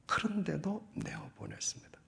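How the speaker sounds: dramatic whisper. Real, not acted. Preacher telling how Abraham still sent his son away, whispered. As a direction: breathe it out in a high, unvoiced whisper.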